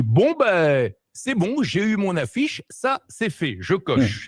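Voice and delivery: lightly voiced smug younger self; smug satisfaction. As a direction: tick it off like a job done. Retelling his own smug thought.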